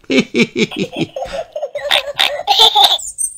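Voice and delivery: very high piglet giggle. A narrator's reading. Peppa giggles while catching apples. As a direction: giggle with delight.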